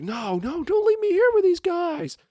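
high, pleading mock panic; imitating a panicked Matt Damon in the back seat; panicked plea. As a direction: beg them not to leave you, freaking out. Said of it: "freaking out".

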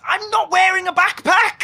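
high, panicked denial; exasperated protest. Acted. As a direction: protest in loud disbelief.

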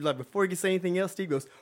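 usual speaking voice

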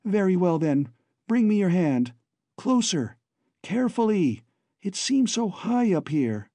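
called up. A narrator's reading. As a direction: call up to distract him. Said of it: "She called instantly".

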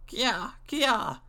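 high, imitated monkey chatter; two high monkey cries; monkey call. A narrator's reading. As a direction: squeal like a storybook monkey. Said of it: "says the monkey".

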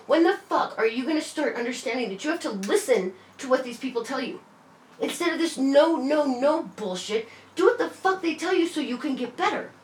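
high, heated scolding that keeps climbing; angry lecture. Retold or imitated. Telling her boyfriend to listen to the doctors, high and angry. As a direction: hammer him with it, exasperated.

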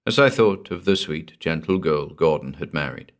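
ordinary delivery

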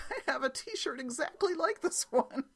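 high-pitched imitation on the edge of laughter; daughter's innocent remark. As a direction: mimic a clueless daughter, barely holding in the giggles. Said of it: "could not stop laughing when she said".

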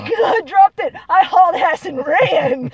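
high, loud laughing retelling; laughing loudly. Real, not acted. Laughing about fleeing the creature.

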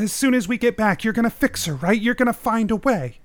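high-pitched nervous pleading; anxious pleading. Acted. Nervous father seeks reassurance.

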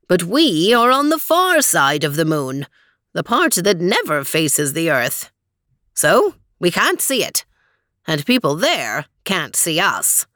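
lightly voiced cheerful guide; cheery announcer. A narrator's reading. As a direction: explain it brightly, like a host. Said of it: "the Weezer's cheery voice".

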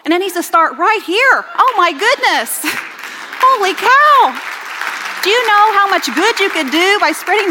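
high, loud preaching at full force; rally the church, rising high and loud; preaching fervour. Acted. Preacher urging congregation to revive social media, full-force preaching.

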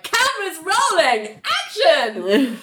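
high, drawn-out mock film-set call; excited director's call. Ling straddles Richard like a film director, excited high call.

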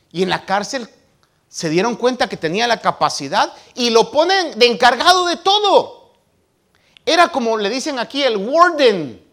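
loud, high preacher's fervour; full-force preaching. Acted. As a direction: preach it out to the congregation.

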